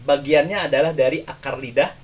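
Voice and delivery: usual speaking voice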